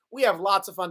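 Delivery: ordinary talk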